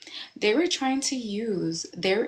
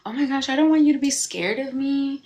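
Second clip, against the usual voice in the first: a higher, louder flustered "oh my gosh"; alarmed reply; 7 semitones higher, 7 dB louder than usual. Retold or imitated. Friend upset that she is scared, a startled flustered reply. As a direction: play the startled friend backpedalling. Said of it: "And they're like".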